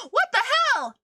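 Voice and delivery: gasp, then high startled yell; high-pitched loud exclamation; shocked outburst. Real, not acted. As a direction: shout it in real shock, very loud. Sudden shock during the game.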